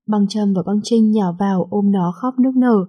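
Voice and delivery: plain reading voice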